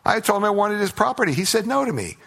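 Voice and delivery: put-on high, whiny-child style complaint; mock sulking. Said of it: "He went back and sulked".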